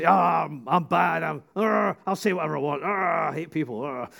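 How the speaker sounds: growling "arr" between defiant boasts; mock tough guy. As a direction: play a hammed-up bad guy snarling. Acting out the hard-knocks guy.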